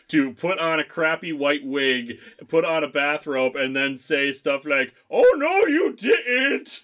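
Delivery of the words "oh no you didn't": high, loud sassy granny parody; hammed-up sassy outburst, pitched way up; Fat Grandma.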